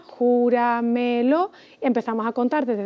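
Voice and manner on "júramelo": "júramelo" as jú, ra, me, lo; slow "júramelo", syllable by syllable; split into syllables. Shown as a demonstration. Teacher separating the word to count, slow syllables. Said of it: "la separamos en sílabas".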